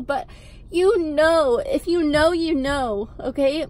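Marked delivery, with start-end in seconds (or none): none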